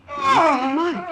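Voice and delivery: high, loud startled cry; startled alarmed cry. Acted. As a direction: gasp in alarm at a sound. Hears someone hurt outside.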